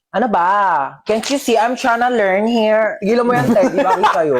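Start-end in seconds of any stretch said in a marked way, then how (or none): none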